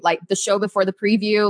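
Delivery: normal talking voice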